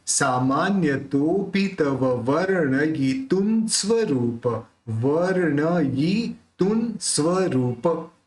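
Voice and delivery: वर्णयितुं with the र joined to व, slowly; slow model recitation. Shown as a demonstration. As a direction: recite each syllable clearly for learners. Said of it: "तो पूरा क्या निकलेगा?".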